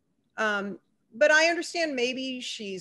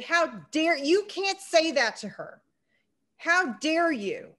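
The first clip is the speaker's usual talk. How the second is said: retold angry rebuke, higher and louder; scolding; 6 semitones higher, 5 dB louder than usual. Young woman tears into the man, mock-indignant and louder. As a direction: scold a stranger for his remark, slowing on the last words. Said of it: "lit into him".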